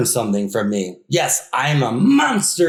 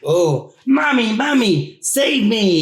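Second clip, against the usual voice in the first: put-on high frightened kid voice; scared child exclaiming; 7 semitones higher than usual. Monster tells the kid how they shout at him, frightened child. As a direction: cry out to Mommy like a scared child. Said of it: "you holler".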